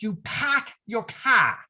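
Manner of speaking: put-on Boston accent, no R sounds; New England accent. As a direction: drop every R, Boston style. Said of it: "they do not use the letter R".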